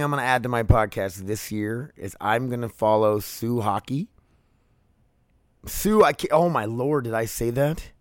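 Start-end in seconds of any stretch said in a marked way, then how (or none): 5.7-7.9 caught off guard. Real, not acted. Catches his own slip.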